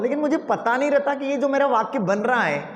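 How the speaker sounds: ordinary delivery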